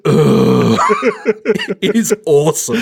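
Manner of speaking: mock-weary "Ugh" of a bored actress; bored groan. Imitating Robin Wright's bored face, a weary "Ugh". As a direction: groan like you can't bear another take. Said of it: "the look on her face of just".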